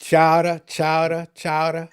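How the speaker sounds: repeating chowder in a Boston accent. Shown as a demonstration. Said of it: "it was my Boston accent".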